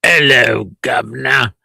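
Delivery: mock British accent. Showing the host how British people speak, mock British accent. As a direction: drop the H like a stock Brit. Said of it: "How do the British speak?".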